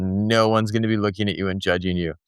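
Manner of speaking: low, level parental reply; retold parent calming a child, pitched low; reassuring parent. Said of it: "and I said".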